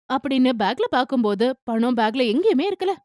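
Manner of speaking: ordinary delivery, nothing marked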